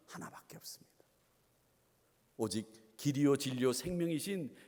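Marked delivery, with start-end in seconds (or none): 0.1-0.9 dropped to a whisper; hushed emphasis. Real, not acted. Pastor tells congregation of the only door, hushed whisper. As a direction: whisper it for solemn weight. Solemn emphasis after loud setup.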